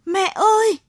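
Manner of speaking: high, loud cry for mother; lost boy screaming for his mother; child's scream. A narrator's reading. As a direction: scream for mother from the dark forest. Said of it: "Cậu thét lên".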